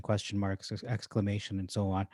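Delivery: usual speaking voice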